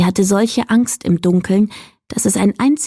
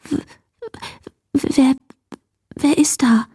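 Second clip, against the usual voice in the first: scared, halting and higher; frightened stammering whisper; 6 semitones higher than usual. A narrator's reading. Mila to whatever is in the cupboard, frightened stammer. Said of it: "flüsterte sie schließlich mit klappernden Zähnen".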